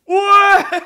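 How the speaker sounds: high, loud mock yell of Tina; retold shout. Tina yelling in the packed theatre, imitated high and loud. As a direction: belt out a joyful yell across the room. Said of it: "Elle, elle gueule dans le théâtre".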